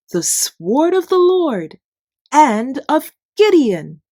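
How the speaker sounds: loud, high rallying shout; battle cry. Acted. Gideon teaching his men the battle cry, shouted high and loud. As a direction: call it out like a war cry to the troops. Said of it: "you also blow your trumpets and say".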